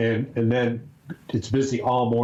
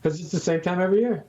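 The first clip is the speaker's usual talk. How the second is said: raised high pitch, quick; 8 semitones higher, faster than usual. Pretend. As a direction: talk up in a high register. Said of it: "Makes my, makes my voice go high".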